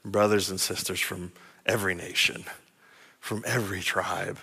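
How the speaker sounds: low, soft reverent delivery; preacher's quiet, reverent lowering; hushed awe. Real, not acted. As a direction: drop to a low hush in wonder. Preacher drops to awed hush.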